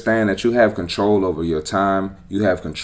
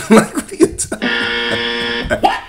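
laughing. Real, not acted. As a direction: laugh through the question. Laughing at her speech.

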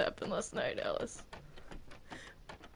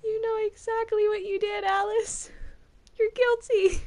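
high, loud cry of guilty; shrill accusing verdict; 10 semitones higher, 5 dB louder than usual. Acted. Judge to the accused, a high loud accusation. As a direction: condemn her like a mad judge.

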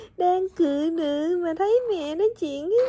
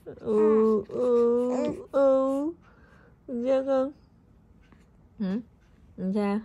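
ordinary talk

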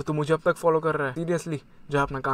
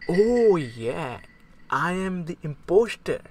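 villain gloat; 2 semitones higher, much slower than usual. Acted. Discovers he is imposter.

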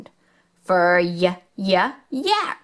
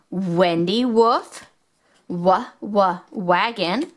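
normal talking voice

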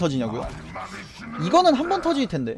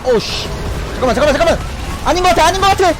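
panicking shout; 9 semitones higher than usual. Real, not acted. Gamer panics mid teamfight.

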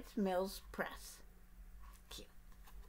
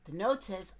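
ordinary delivery, nothing marked

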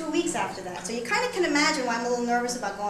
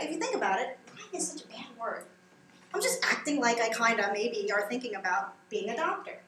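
ordinary delivery

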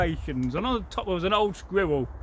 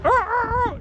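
much higher, louder screeching ah ah ah; hawk-like screech; 5 dB louder than usual. Retold or imitated. Pheasant screeching at the creatures, higher and louder. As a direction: screech like a bird of prey. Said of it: "more like a hawk".

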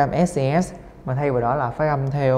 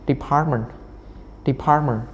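"Department, department" each stressed on PART; second-syllable stress. Shown as a demonstration. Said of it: "âm hai".

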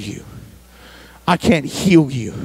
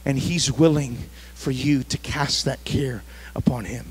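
whispered assurance, softer; 4 semitones lower, 6 dB quieter than usual. Real, not acted. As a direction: lean in and whisper the promise. Intimate assurance at sermon end.

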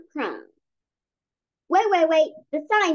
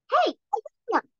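hamster voice; 7 semitones higher, faster than usual. Acted. Hamster character interjects.

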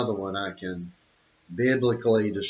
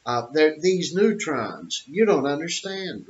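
higher, whiny brush-off of a know-it-all; mocking a scientist; 6 semitones higher than usual. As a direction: mimic a condescending scientist dismissing you. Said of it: "they go to photons and neutrons".

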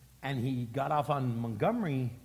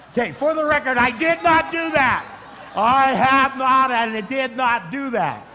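loud denial; 10 semitones higher, 13 dB louder than usual. Real, not acted. Pastor joking he sold the drugs, shouting loudly. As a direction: shout it out, half laughing.

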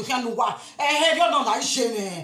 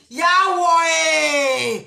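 loud shouted call; 4 semitones higher, 5 dB louder, much slower than usual. Real, not acted. Calling out Yawo to a friend far off, loud shout.

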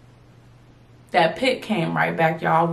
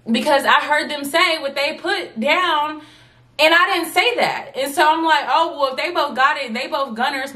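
pitched way up, voice near breaking; high tearful panic; 8 semitones higher than usual. Retold or imitated. Student retelling tearful car breakdown, high and upset. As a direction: wail it like fresh tears after the exam.

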